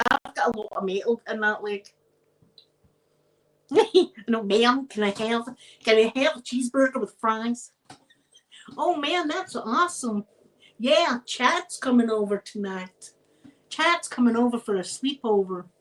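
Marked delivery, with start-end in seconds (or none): 4.3-15.7 put-on lightly done American accent, a bit butchered; hint of an American accent, playful. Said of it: "I can do a wee bit of an American accent".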